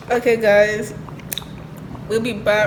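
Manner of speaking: loud upbeat host announcement; YouTuber voice. Pretend. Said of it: "Okay, my turn".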